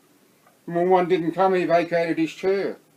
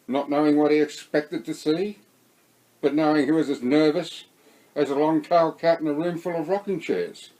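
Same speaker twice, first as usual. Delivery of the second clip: ordinary delivery, nothing marked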